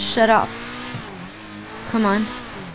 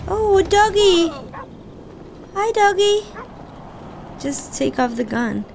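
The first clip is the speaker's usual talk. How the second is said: cooing at a dog; 6 semitones higher, 4 dB quieter than usual. Real, not acted. Player talking sweetly to the dog in the game. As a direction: coo to the doggy.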